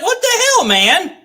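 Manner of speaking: mock the baffled linebacker; imitating Anzalone. Streamer voicing a baffled player: mock incredulous protest. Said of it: "He's like".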